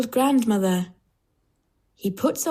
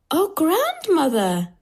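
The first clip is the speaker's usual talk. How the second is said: put-on high, drawn-out child exclamation; wondering little girl, high and slow; 9 semitones higher than usual. A narrator's reading. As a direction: exclaim slowly in a high girl's voice. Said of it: "she says".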